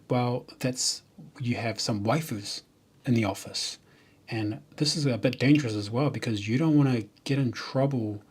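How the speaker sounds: usual speaking voice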